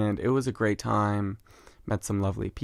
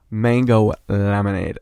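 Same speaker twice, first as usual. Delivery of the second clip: excited; 8 dB louder, slower than usual. Real, not acted. Excited craving.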